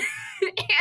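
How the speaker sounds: a real burst of high laughter; high squealing laugh. Real, not acted. Laughing at her dad's lost gift cards, high squeal.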